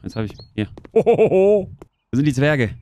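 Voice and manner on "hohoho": loud hearty ho ho ho; mock santa laugh. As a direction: laugh like Father Christmas. (lautes Weihnachtsmann-Hohoho)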